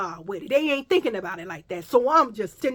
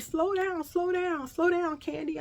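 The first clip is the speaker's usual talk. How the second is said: friend pleading slow down; 8 semitones higher, 4 dB quieter than usual. Retold or imitated. Friend warning her to slow.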